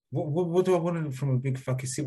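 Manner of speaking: ordinary delivery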